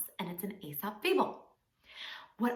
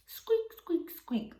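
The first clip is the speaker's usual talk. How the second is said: very high, tiny squeaks; mouse squeak; 8 semitones higher, much slower than usual. Pretend. Practising the story cue with children, tiny high squeaks. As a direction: give your best little mouse. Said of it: "best mouse with that squeak".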